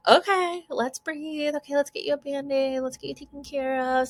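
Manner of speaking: high, sing-song caretaker voice; soothing parent. Retold or imitated. Parent tending a hurt child, sing-song soothing.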